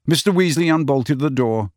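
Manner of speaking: plain reading voice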